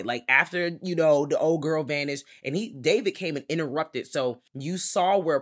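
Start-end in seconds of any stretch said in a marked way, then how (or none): none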